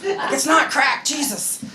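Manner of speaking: high, drawn-out comic protest; mock exasperation. Acted exasperated reply to mom.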